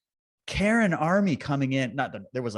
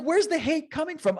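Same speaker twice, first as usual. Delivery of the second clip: much higher, wide-eyed wonder; high bewildered. Retold or imitated. Asking the angry protesters, in a high, baffled voice. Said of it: "I'm like".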